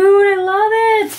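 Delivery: excited squeal. Real, not acted. Loving her friend's birthday gift, high excited gush. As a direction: hold it high and loud with delight.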